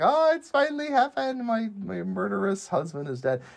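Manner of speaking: high-pitched imitation of a relieved wail; mock joyful wail. Host imagines the queen rejoicing at her husband's death, mock joyful wail. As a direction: start very high, as if wailing for joy. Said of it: "wailing for joy".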